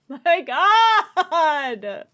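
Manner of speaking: high, loud wail of overwhelmed emotion; loud tearful wail; tearful outburst. Real, not acted. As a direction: cry it out, overcome by the scene. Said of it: "My eyes are tearing".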